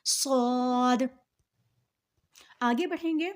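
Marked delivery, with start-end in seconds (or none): 0.1-1.0 صاد with firm hiss and rounded long "aw"; thick, hard s. Shown as a demonstration. Teacher summing up the rule, صاد thick and hard. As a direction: make صاد heavy and hard, held level. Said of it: "موٹا بھی پڑھیں گے اور سختی سے".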